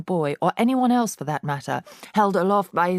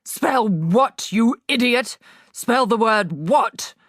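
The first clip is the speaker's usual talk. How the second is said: higher, louder demanding headmistress, slowing at the end; impatient headmistress; 6 semitones higher, 5 dB louder, slower than usual. A narrator's reading. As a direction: bark the order at the fool. Trunchbull demands spelling.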